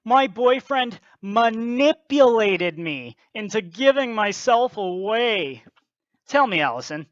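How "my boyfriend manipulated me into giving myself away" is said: mocking quote. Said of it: "She says".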